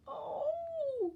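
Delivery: put-on high startled child's cry; sudden high child's "Oh!"; boy's cry. A narrator's reading. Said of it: "cried the little boy".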